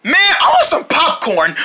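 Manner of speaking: ordinary talk, nothing marked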